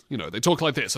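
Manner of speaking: normal talking voice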